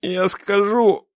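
high, very slow surrender; forced out. A narrator's reading. Captive gives in to the armed father, forcing it out. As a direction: squeeze the words out reluctantly. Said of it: "выдавил Прохоров".

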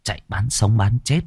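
plain reading voice

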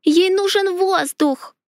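high piercing squeal, slow; shrill screech. A narrator's reading. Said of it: "пронзительно заверещала Леонари".

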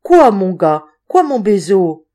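loud, taken-aback questioning; startled surprise. A narrator's reading. Woman to the feverish boy kissing her hand, startled surprise. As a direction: ask it loudly, caught off guard. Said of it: "car de surprise son cœur battait".

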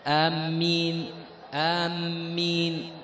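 آمين with a short alif and a doubled, stressed m; doubled m amen. Shown as a demonstration. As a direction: lean on the m of آمين. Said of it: "مع تشديد الميم". (آمين بقصر المد وتشديد الميم)

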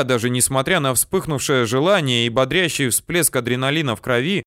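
ordinary delivery, nothing marked

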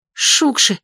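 cracking cry of alarm; voice breaking. A narrator's reading. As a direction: let the voice crack. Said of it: "голос Оли сорвался". (голос срывается)

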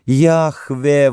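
high, pleading call; anguished exclamation. A narrator's reading. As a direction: cry out to God in grief. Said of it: "воскликнула она". (отчаянный возглас мольбы)

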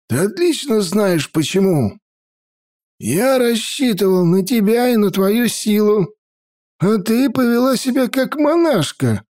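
put-on old mage voice. A narrator's reading. Grandfather scolds.

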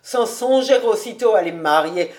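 loud, amused teasing; hearty mocking exclamation; roaring with amusement. A narrator's reading. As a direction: tease loudly, on the edge of laughter. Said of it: "s'écria Cobus en riant aux éclats".

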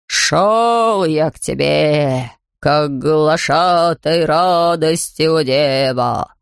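low, very slow quavering proclamation. Acted. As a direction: proclaim it slowly like an old herald. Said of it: "возгласил он дребезжащим голосом".

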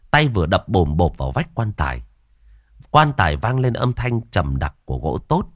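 usual speaking voice

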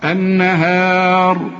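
very slow chanted النهار, thick final r; heavy r at stop. Shown as a demonstration. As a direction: hold النهار long, close on a heavy r. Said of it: "تفخم عند الوصل وعند الوقف". (تفخيم راء النهار عند الوقف)

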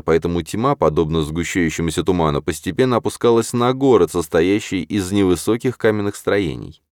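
ordinary delivery, nothing marked

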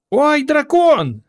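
very high, slow frightened squeal; squealing fright. A narrator's reading. Girl squeals on seeing a dragon, frightened squeal. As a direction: shriek it out at the sight of the monster. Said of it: "вириснула Варка".

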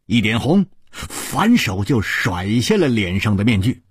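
plain reading voice

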